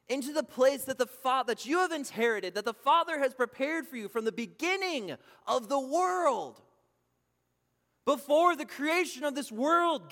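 loud, ringing pulpit proclamation; fervent preacher voice, slowing on the key words; preaching at full force. Acted. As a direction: proclaim it to the back row. Preaching the king's welcome.

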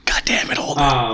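whispered curse. Real, not acted. Friend cursing quietly during book chat, whispering. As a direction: mutter it under the breath. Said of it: "whispered aside".

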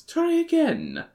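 high-pitched character voice; Miss Tibbs. A narrator's reading. Secretary answering the president, in a high voice. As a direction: lift it far up for Miss Tibbs. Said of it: "said Miss Tibbs".

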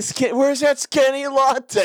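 high, drawn-out mock call; imitated latte caller. Imitating the guy who kept asking for the latte, mock call-out. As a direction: call out slowly in a high put-on voice. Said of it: "he kept saying".

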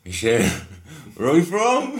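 high, loud call into the dark. Retold or imitated. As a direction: call out to someone unseen. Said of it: "So I was like".

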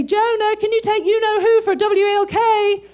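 high, loud, exaggerated singsong call; hammed-up, high-pitched spelling-it-out voice; coded household talk. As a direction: call out a coded request over the dog's head. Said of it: "That's how we speak in our house".

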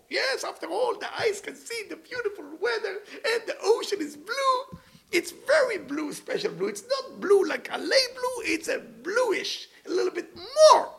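high, excited mock tourist voice, laughing through it; mocking a bragging tourist. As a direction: ham up a giddy show-off raving about a secret beach.

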